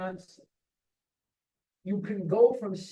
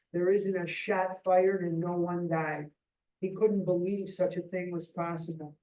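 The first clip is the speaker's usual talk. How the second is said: ordinary talk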